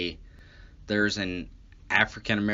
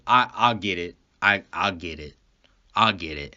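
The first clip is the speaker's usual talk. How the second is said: low, halting give-in; imitated resigned surrender; 6 semitones lower than usual. Driver giving in to cop's search, low imitated resignation. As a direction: give in with a lowered, stammering voice.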